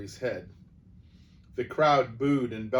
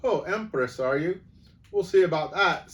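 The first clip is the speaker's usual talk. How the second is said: raised, jeering Cockney voice; Cockney accent; 5 semitones higher, 7 dB louder than usual. Acted. Crowd voice answering the self-styled Empress, Cockney accent. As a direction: heckle with a bit of Cockney. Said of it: "supposed to be a little bit of Cockney".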